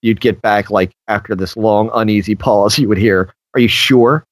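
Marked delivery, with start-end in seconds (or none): 3.5-4.2 a mocking, faintly nasty "Are you sure?"; sardonic. Shown as a demonstration. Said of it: "that kind of sardonic, almost borderline nasty".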